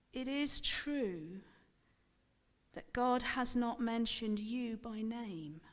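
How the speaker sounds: husband speaks slowly to calm wife. Acted. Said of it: "he spoke slowly".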